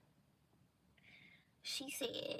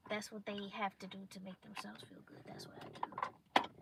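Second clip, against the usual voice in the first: whispering; 2 semitones lower, 6 dB quieter than usual. Real, not acted. Continues whispering.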